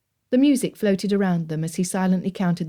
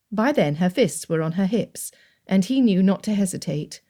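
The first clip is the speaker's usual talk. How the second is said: usual speaking voice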